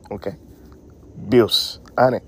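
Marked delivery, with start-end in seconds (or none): none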